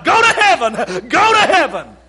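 laughing mock yell; mock screaming. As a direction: scream it at the sinners, half joking. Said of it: "started screaming at them".

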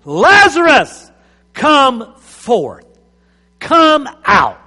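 loud, high call into the tomb; ringing command to the dead; commanding shout. Acted. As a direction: shout him out of the grave. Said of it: "Then Jesus shouted".

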